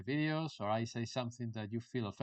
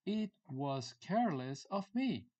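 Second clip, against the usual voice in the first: higher, slowed-down teaching delivery; deliberate, raised-pitch example sentence; slow model phrase; 5 semitones higher than usual. Shown as a demonstration. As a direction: space out "It was careless of me" for learners.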